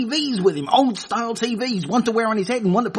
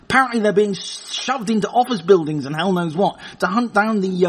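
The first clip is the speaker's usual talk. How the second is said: usual speaking voice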